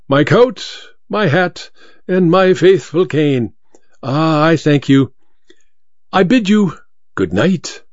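lightly voiced gracious magician; suave masked magician. A narrator's reading. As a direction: list each thing, then take a courtly leave.

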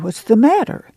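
roused sleeper's insistent question; demanding. A narrator's reading. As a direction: demand to know what is wrong. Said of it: "Pa demanded".

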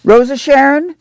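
calling a daughter across the boxcar. A narrator's reading. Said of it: "Ma called".

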